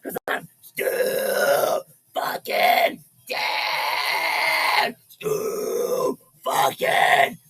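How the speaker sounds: slow growled metal vocals; slow raspy growl, loud and drawn out. Acted. Metal-style growled shout.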